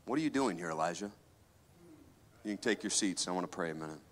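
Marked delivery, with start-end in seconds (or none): 0.1-1.1 "What are you doing here, Elijah?" said much softer, as a hushed gentle voice; the Lord's still small voice, hushed and soft; gentle whisper. Acted. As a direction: let the question fall to a soft hush. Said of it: "came a gentle whisper".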